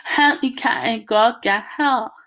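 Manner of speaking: slow, mushy-mouthed cry for help; stuck-lipped mumbling plea. A narrator's reading. Byron to his brother with lips frozen to the mirror, mumbled plea. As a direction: plead with lips stuck fast.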